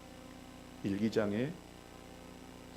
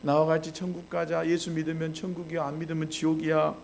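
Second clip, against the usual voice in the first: persistent nagging; 5 semitones higher than usual. Retold or imitated. Child pestering dad to come to church, relentless nagging. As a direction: imitate a child's relentless, high-pitched pleading. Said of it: "계속해서".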